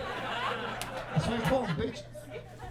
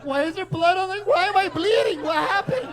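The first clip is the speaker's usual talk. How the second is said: high, louder panicked confusion; confused shock; 12 semitones higher, 7 dB louder than usual. Retold or imitated. Woman kicked awake, high panicked confusion. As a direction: wake up bleeding and freak out. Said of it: "You're like".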